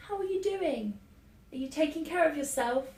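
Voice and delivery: high-pitched polite check-in; mock pleasantries. Relative opening the weekly check-in, high-pitched pleasantries. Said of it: "We'll start with the pleasantries".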